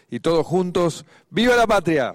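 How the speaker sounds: rising to a loud rally cry; ranting dictator speech. Real, not acted. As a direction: build up, then shout "viva la patria". Rally cry closing patriotic speech.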